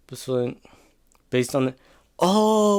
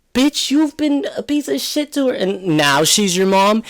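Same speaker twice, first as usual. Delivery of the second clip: exasperated rant; 6 semitones higher than usual. Real, not acted. Ranting at Henry for how he treated his mom, pitched-up outrage. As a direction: pitch jumps way up in outrage, telling him off.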